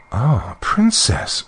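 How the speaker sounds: high, slow tearful lament; plaintive, high-pitched acted weeping. A narrator's reading. Queen lamenting to the princess, weeping. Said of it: "said the queen, weeping".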